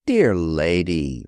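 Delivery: slow and soothing, talking down; bland, patronizing. A narrator's reading. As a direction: humour her like a fussy child. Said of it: "bland tones to an unreasonable child".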